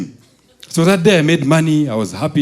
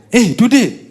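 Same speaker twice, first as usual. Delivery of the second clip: surprised; 10 semitones higher than usual. Retold or imitated. Dad surprised on phone.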